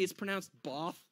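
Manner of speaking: soft correction with Bath as "Bahth"; posh British pronunciation. Retold or imitated. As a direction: say Bath with a long, affected "ah". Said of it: "there was a woman in the front".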